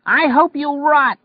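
very high, loud slow scream. Acted. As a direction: scream it down from the window. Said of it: "she screamed down at him".

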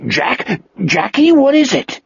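high, urgent character voice; worried creature calling a friend; Wolf, concerned and excited. A narrator's reading. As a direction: ask in anxious excitement, pitch leaping up. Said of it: "looking both concerned and excited".

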